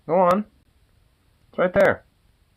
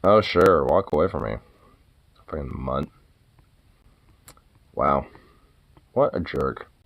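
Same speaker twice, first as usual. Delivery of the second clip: low sarcastic grumble; 13 semitones lower than usual. Real, not acted. Owner grumbles at the dog walking away, low and sarcastic. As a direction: grumble it under your breath, offended.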